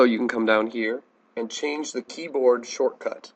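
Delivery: ordinary talk, nothing marked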